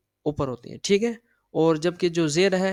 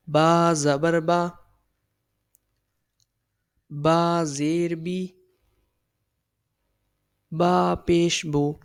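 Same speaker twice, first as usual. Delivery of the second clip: slow, careful drill of the vowels; slow recitation; much slower than usual. Shown as a demonstration. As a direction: spell each syllable out slowly for the class. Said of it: "نہ لمبا کھینچنا ہے". (آہستہ آہستہ سبق پڑھانا)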